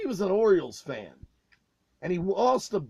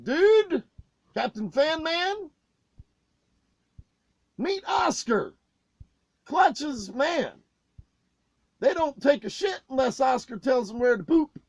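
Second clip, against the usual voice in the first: mocking introduction; 5 semitones higher than usual. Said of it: "And I said".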